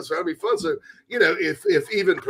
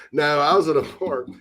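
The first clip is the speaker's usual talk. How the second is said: normal talking voice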